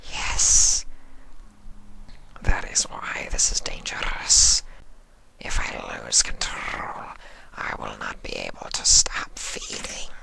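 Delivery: hushed low creature voice; whispering changeling. Acted.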